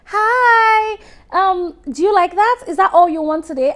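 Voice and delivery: hammed-up friendly cashier greeting a customer; cashier Rachel. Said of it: "Rachel is like".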